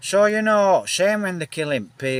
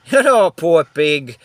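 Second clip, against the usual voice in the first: laughing; 4 semitones higher, 8 dB louder, slower than usual. Real, not acted. Laughing at the pig's fate.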